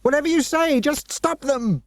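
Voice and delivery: very high giving-in cry; desperate shout. Acted. Dinah giving in to save friends, high desperate shout. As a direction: surrender at the top of her voice. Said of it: "she shouted".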